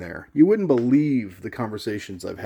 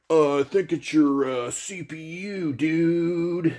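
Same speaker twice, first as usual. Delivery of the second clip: mocking forum guy; 5 semitones higher, slower than usual. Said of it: "they're just like".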